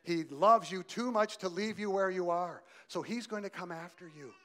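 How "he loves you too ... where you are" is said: "He loves you" pressed home, louder and higher; preacher raising his voice to repeat the line. Shown as a demonstration. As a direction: drive the point home the second time. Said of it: "Did you hear what I said?".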